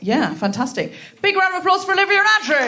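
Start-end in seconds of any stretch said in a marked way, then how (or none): 1.2-2.7 high, fast announcer's shout; calling for applause. Real, not acted. Host to live audience after guests' chat, rousing applause call. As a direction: whip up the crowd, high and quick.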